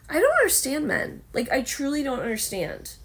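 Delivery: exasperated. Real, not acted. Exasperated complaint.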